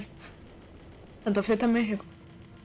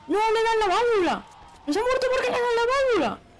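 outraged shout; 10 semitones higher than usual. Real, not acted. Player yelling at the game over an unfair hit, outraged shout. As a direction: yell it in disbelief.

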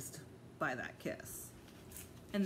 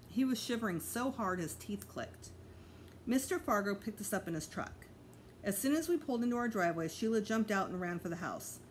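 usual speaking voice

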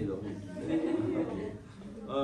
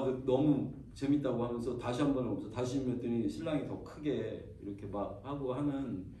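normal talking voice

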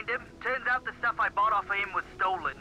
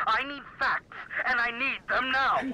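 angry; 5 semitones higher, slower than usual. Acted. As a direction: bark the demand with impatience. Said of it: "He sounds so angry".

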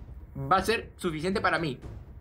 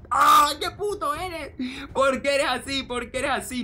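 drawn-out high cry, then pleading complaint; real high-pitched gamer rage; exasperated outburst; 6 semitones higher, slower than usual. Real, not acted. Gamer raging at his opponent's play style, exasperated outburst.

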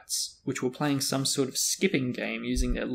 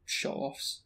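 soft mutter under the breath; muttered grumble; 8 dB quieter than usual. A narrator's reading. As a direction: mumble it sulkily, much softer. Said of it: "Ron muttered".

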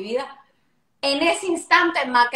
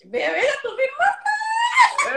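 much higher, slow, shouted tease; surprised shout; 14 semitones higher, much slower than usual. Real, not acted. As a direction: pounce on the slip with a big high cry. Teasing colleague who leaked news. (grito agudo y lento de sorpresa burlona)

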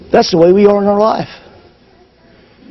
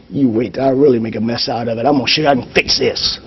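softer, fast, stubborn muttering; stubborn inner voice; 6 semitones lower, 6 dB quieter, faster than usual. Retold or imitated. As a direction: mutter it like you know better. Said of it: "we stand there going".

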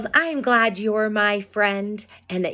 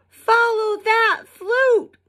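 high, slow call after the flute; Fifi's cry. Acted. Fifi calls to Frog, high and eager. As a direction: shout the chase order in a little ferret voice. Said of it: "cried Fifi".

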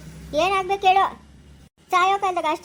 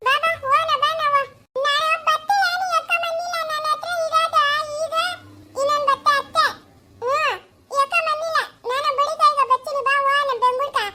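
put-on much higher small-child voice, calling eagerly; 7 semitones higher than usual. Little girl coaxing her uncle to go to Bengaluru, in a high child voice.

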